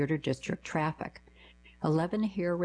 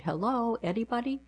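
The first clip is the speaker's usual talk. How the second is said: high call with a questioning rise; lightly acted shout for anyone around; mild mock yell; 7 semitones higher than usual. A narrator's reading. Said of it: "but the yelling continued".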